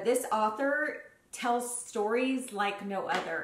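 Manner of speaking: normal talking voice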